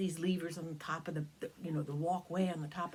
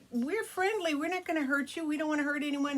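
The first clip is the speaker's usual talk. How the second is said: put-on lightly higher childlike voice; a hint of a little girl pleading; young Tia's voice; 8 semitones higher than usual. Tia reassuring the dragon, lightly childlike. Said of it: "Tia says".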